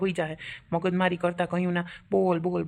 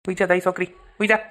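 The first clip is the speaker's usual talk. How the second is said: louder, sharp command to sleep; scolding; 7 dB louder, faster than usual. Acted. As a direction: bark at the child to sleep. (બાળકને મોટેથી ધમકાવવું)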